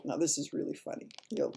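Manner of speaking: usual speaking voice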